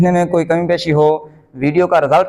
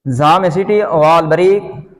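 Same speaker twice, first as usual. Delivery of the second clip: usual speaking voice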